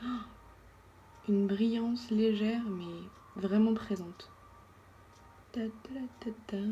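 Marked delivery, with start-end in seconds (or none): 5.5-6.6 soft unvoiced ta ta ta under the breath; whispered ta-ta-ta. Real, not acted. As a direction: whisper the little rhythm to yourself. Humming along while blending.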